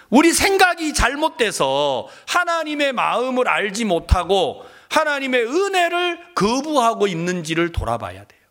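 ordinary talk

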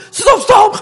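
high, loud urgent shout; shouting stop. Retold or imitated. Pastor showing how he yells at his driving wife, loud alarm. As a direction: bark it out in a panic. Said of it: "하니까 막 소리 지른다".

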